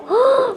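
sharp high gasp of amazement; impressed gasp. Acted. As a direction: gasp as if wowed by the room. Gasps at another room.

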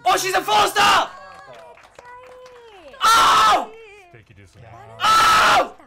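loud, high outburst; real shock, shouted at the screen; shocked yelling. Real, not acted. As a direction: scream in stunned disbelief.

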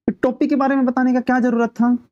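high-pitched mock reproach; annoyed. Karmu scolds Dharmu for mentioning the cap, annoyed. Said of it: "गुस्सा हो रहा है कर्मू".

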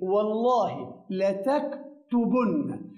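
والله لتكتبن drawn out slowly and clearly; slow model phrase. Shown as a demonstration. Teacher repeating the example slowly for the class. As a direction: model لتكتبن at half speed. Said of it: "فبقول إيه؟".

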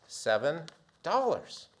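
normal talking voice

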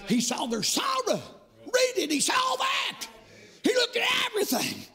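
rhythmic chanted preaching, rising to shouts; fiery revival preaching. Acted. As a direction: whoop the sermon over the organ.

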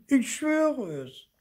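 very high, slow, loud whine; little ghost howling in protest; wailed oath. A narrator's reading. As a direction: swear it in a drawn-out high wail. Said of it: "heulte Zipfel".

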